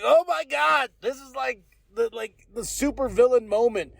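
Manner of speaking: high, awestruck outburst, easing down; hyped-up holler of amazement; astonished exclamation. Retold or imitated. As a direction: re-enact your jaw-dropped fan reaction. Retelling his amazed reaction to twist.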